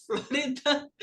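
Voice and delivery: laughing through "Florida". Real, not acted. Teacher tripping on a word in class, laughing. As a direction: say it while chuckling at the slip.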